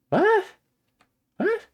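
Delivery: very high, rising squeak of shock; stunned disbelief. Real, not acted. Disbelief at learning he's German.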